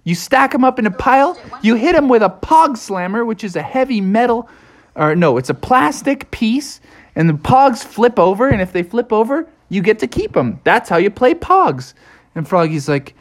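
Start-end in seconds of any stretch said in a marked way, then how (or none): 0.0-11.9 put-on higher, fast know-it-all kid; lightly voiced eager beaver; Bucky explaining. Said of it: "Bucky was like".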